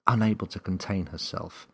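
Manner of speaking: ordinary delivery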